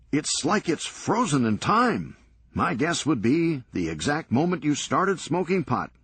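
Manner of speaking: teasing Serge. Acted. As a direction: needle Lenny about his frozen-in-time room. Serge teasing Lenny about room.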